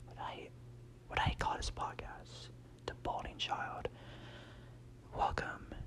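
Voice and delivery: hushed podcast whisper; ASMR whisper. Pretend. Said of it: "This is the A S M R Whisper Podcast".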